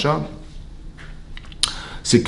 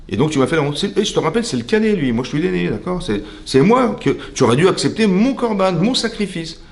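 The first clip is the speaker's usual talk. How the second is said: mock indignant complaint, pitched high; an elder brother insisting on his rank, higher-pitched; Caïn, aggrieved; 6 semitones higher than usual. Caïn reminds God that he is the eldest, aggrieved. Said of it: "Il s'est mis en colère".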